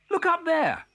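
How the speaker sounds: high, loud excited call; piercingly high shout of discovery; child crying out. A narrator's reading. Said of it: "cried one".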